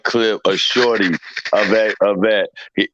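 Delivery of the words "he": normal talking voice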